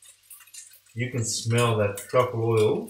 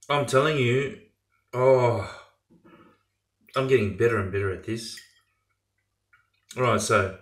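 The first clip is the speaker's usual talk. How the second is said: ordinary talk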